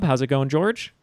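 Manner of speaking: ordinary delivery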